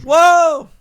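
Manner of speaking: high, loud burst of surprise; startled whoa. Real, not acted. Reacting to an on-screen moment, a loud startled whoa. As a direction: blurt it out, caught off guard.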